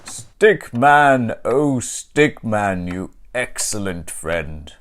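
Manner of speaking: loud, slow booming thanks; grateful Santa. Acted. Santa thanking his rescuer, loud, slow and hearty.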